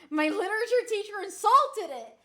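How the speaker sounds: amused outburst. Real, not acted. Laughs telling teacher's insult.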